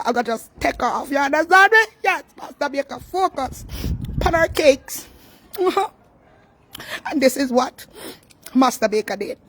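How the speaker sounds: loud, high and jubilant boasting; hyped up. Real, not acted. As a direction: hype yourself up. Hyped loud self-praise.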